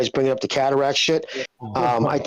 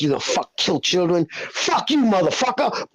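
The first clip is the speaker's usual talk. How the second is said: rising into a higher mock shout on the last words; mock angry movie line; 4 semitones higher than usual. Retelling Scarface to friends: hammed-up furious gangster line. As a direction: quote the gangster's outrage, hammed up.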